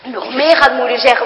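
ordinary delivery

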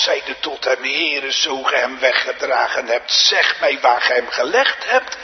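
loud scripture declamation from the pulpit; full-force preaching. Real, not acted. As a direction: ring the words out over the congregation. (met luide stem voordragen vanaf de preekstoel)

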